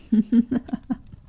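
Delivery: wicked little laugh; sly chuckle. Acted. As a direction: laugh at a private joke. Toast, hinting at blood.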